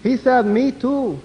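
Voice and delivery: high, slow, deadpan mock Mexican accent; high mock accent, drawn out. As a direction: deliver the punchline slowly, in accent. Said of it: "What did he say?".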